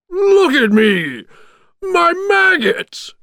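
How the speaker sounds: high, drawn-out villain's wail; crying out. A narrator's reading. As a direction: wail it out slowly, high and loud. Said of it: "cried Oogie Boogie".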